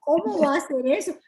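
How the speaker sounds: high-pitched shocked churchgoer; mock scandalized. Voicing members shocked the pastor's wife gets angry, mock scandalized. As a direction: gasp it out high, in mock horror.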